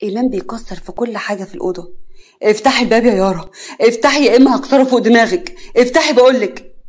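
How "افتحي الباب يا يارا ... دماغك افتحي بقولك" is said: loud, threatening shout; furious order at full volume; angry demand. Acted. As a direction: shout it through a locked door. Violent fit, demands door opened.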